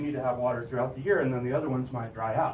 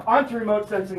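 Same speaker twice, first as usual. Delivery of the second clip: usual speaking voice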